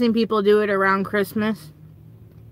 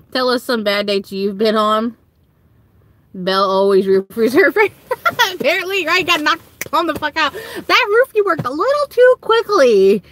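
ordinary talk, nothing marked

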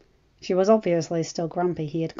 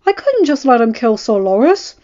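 louder, higher defensive reply; husband justifying himself, raised voice; defensive retort; 5 semitones higher, 12 dB louder than usual. A narrator's reading. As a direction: argue back in self-defence.

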